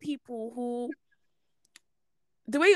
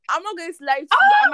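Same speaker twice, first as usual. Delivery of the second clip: loud outburst; 8 semitones higher, 9 dB louder, faster than usual. Real, not acted. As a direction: pitch jumps up, loud and fast. Heated rant, loud.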